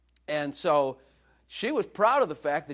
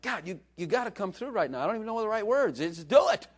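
acted desperation; 8 semitones higher than usual. Preacher acting out a prayer in trouble, desperate plea building. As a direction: beg God urgently, rising in force. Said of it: "when you're desperate".

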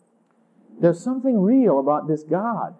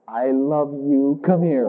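tender; 5 semitones lower, slower than usual. Acted. Jesus invites the ashamed sinner close, slow and tender. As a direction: say it slowly and tenderly, like an open invitation. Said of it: "Oh, Jesus, don't say it like that".

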